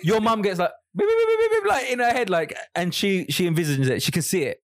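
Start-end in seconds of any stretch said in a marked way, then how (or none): 1.0-1.7 rapid, high-pitched beeping; imitated alert beeps. Podcaster joking about mum's telepathic alert, high mock beeping. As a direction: mimic an alarm going off in someone's head.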